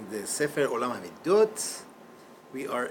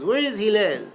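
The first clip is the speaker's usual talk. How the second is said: loud rude call; shouted demand; 5 semitones higher, 7 dB louder than usual. Retold or imitated. Heckler at the door shouting for Hillel. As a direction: yell for him rudely.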